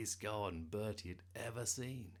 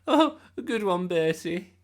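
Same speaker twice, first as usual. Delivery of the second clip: put-on loud, much higher, grinning boy; grinning amusement; 12 semitones higher, 18 dB louder than usual. A narrator's reading. Eugene enjoying Bertie's supposed joke, grinning. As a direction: call it out with a big amused grin. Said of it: "grinned Eugene".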